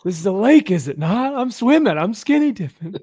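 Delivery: high, loud mock-innocent protest; smug drunk. Drunk skinny dipper to scolding boaters, loud mock innocence.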